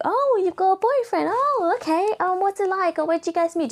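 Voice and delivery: high-pitched gushing sudden interest; squeaky-high imitation of fake-interested questioning; mock nosy acquaintance. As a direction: mimic someone suddenly fascinated by your love life. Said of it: "they're like".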